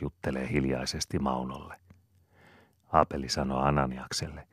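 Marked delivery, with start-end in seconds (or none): none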